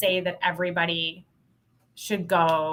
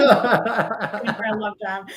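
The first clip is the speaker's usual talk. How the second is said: laughing; 3 semitones higher, 5 dB louder, much slower than usual. Real, not acted. Laughing after phone story.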